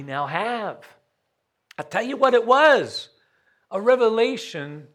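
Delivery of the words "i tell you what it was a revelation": high, louder, pressing the answer home; rising to proclaim. Acted. Preacher revealing the answer to congregation, loud. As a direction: announce the answer with force.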